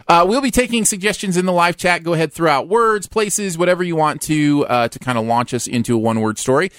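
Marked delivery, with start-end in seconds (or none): none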